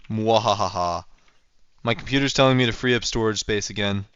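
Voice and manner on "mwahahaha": villain's mwahaha laugh; evil cackle. Pretend. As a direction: cackle like a cartoon baddie. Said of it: "and I am evil".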